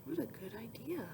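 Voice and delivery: hushed whisper of approval; whispered praise. Acted. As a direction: whisper it with wonder. Hushed praise during reading.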